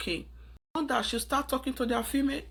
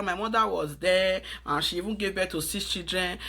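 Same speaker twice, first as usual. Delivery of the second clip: normal talking voice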